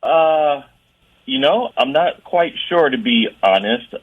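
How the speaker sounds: usual speaking voice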